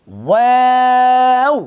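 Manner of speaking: ওয়াও drawn out slowly, lips round first; slow ওয়াও from round to spread lips; waw drill. Shown as a demonstration. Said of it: "ওয়াও যখন বলবো একটু খেয়াল করেন".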